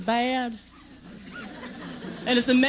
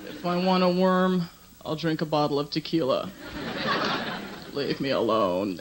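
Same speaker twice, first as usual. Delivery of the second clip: groggy low grumble; 7 semitones lower than usual. Retold or imitated. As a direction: drag it out from under the covers. Groggy daughter answering mother.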